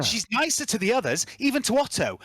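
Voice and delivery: high, bright machine-spirit voice; Alyssa voice. Acted. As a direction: voice the little device up high. Alyssa urging the player on.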